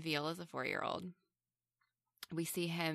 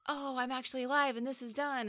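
higher, a hammed-up sudden relief; mock the hero's instant turnaround, pitched up; mocking imitation; 8 semitones higher, faster than usual. Podcaster mocking the film's hero waking up, higher mock relief. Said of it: "And then he's like".

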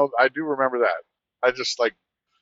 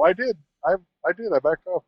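higher-pitched, defensive insistence; retold protest; 7 semitones higher than usual. Trucker defending himself to the cop, high indignant protest. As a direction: plead your case in a raised pitch.

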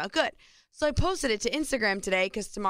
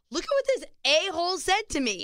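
outraged; 8 semitones higher than usual. Retold or imitated. Mock outraged reaction to mean comment.

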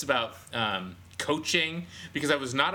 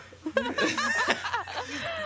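high burst of laughter. Real, not acted. Laughing at robot Todd.

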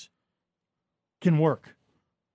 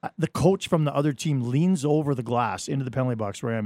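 normal talking voice

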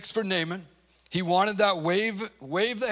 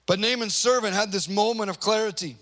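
ordinary delivery

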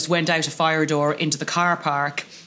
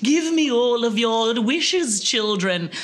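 a hint of a grand snow witch, slow and drawn out; lightly voiced witch demanding wishes; 7 semitones higher, slower than usual. Snow witch calling children to give their wishes, lightly voiced.

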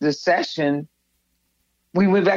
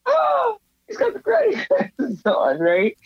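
surprised. Retold or imitated. David amazed at granny glasses.

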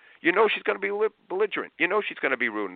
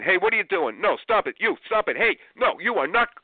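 high, louder parent yelling; yelling at kids; 11 semitones higher, 4 dB louder than usual. Retold or imitated. Parent rushing in and yelling at misbehaving kids. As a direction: scold the kids in a panic. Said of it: "you're yelling at burgers".

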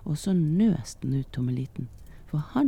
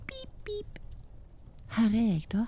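squeaky peeps, then high little voice; high, tiny storybook character; tiny thumb-sized boy; 8 semitones higher, 4 dB quieter than usual. A narrator's reading. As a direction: call out small from a hiding place. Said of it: "sa Tommeliten".

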